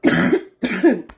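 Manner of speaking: sudden loud hacking cough; coughing fit. Real, not acted. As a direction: cough hard, choking on coffee. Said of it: "Coffee just went down the wrong pipe".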